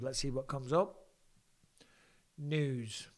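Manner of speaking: ordinary talk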